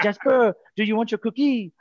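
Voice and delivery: put-on light French accent, coaxing the kid; French lady voice. French deli lady offering Jasper a cookie, high French accent. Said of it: "She's French".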